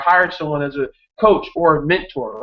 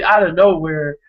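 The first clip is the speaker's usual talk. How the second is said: ordinary talk, nothing marked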